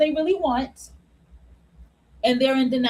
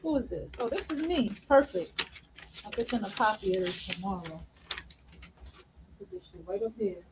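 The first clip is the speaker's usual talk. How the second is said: much softer, low, half to herself; muttered aside; 5 semitones lower, 11 dB quieter than usual. Real, not acted. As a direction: mumble it offstage while sorting papers. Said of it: "aside while handling papers".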